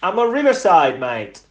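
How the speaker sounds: loud high Cockney accent. Shown as a demonstration. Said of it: "you definitely don't mimic accents".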